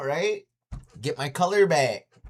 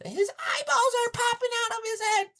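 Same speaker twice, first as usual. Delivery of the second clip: put-on much higher girl's voice, urgent; alarmed girl. Girl watching the choking cries out in a high voice.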